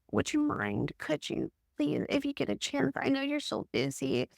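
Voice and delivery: soft, high, timid pleading; passive. Shown as a demonstration. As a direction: tiptoe around them, apologetic. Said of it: "That's passive communication".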